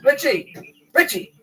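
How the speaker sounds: high loud mock call; loud repeated call. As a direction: shout the name across the room. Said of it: "use that in a fucking movie".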